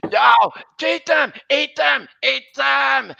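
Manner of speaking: high, loud repeated shouts; acted panicked scream. Squidward facing the freight car, high screamed pleas. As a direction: shriek each phrase in mock panic.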